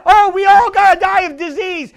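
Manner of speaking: loud, high mock outcry; imitating worldly talk. Preacher quoting the world to the church, loud mocking imitation. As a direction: blare it out as a mocking quote. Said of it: "That's the way the world talks".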